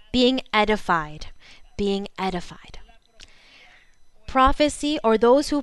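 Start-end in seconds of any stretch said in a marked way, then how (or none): 1.8-2.8 unvoiced whisper of the answer; whispered echo. Shown as a demonstration. Preacher whispering the answer back to the congregation. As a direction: breathe it out as a hushed echo. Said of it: "That is called what?".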